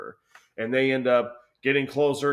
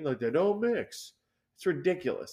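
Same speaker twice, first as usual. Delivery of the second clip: ordinary talk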